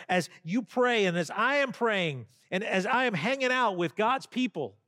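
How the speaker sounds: preaching. Acted. Pastor to congregation, preaching with rising force. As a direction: lift the room as you list each way.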